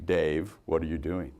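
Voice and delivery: calm computer speaking to Dave; low quiet robotic tone; HAL computer voice. Retold or imitated.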